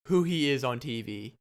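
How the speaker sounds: normal talking voice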